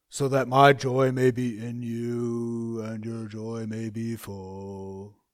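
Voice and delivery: mock-dour, heavy and slow; dour and miserable. Shown as a demonstration. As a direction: drag it out like a joyless preacher. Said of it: "How did he say it?".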